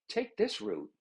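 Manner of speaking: a gentle prompt from intuition; quiet inner voice. Acted. Inner voice quietly giving directions.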